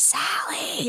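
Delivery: eerie breathless whisper of a name; creepy whisper. Retold or imitated. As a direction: breathe the name like a ghost calling. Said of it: "it was that whispering".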